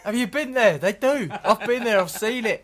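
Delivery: hammed-up ignorant traveller, laughing; mock bigot insisting. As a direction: shout it like a pub bore who's been there. Mocking an ignorant know-it-all.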